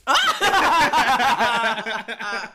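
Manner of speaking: loud high laugh bursting out; big burst of laughter. Real, not acted. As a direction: laugh hard at the joke.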